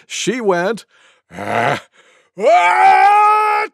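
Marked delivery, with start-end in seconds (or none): 1.3-3.7 wind-up into long achoo; pause, then let the mock sneeze rip; huge pretend sneeze. Tiger outdoes everyone, huge pretend sneeze. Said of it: "gave the loudest sneeze you could ever imagine".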